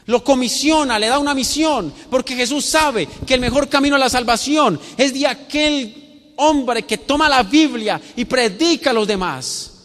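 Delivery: high, loud pulpit delivery; impassioned preaching. Acted. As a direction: drive each phrase home to the whole congregation. Preacher at full force.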